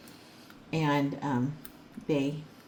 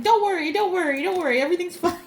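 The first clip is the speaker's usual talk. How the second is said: much higher and louder hurried reassurance; mock soothing mover; 11 semitones higher, 7 dB louder, faster than usual. Main mover calming her down, high hurried reassurance. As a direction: wave it off brightly, over and over.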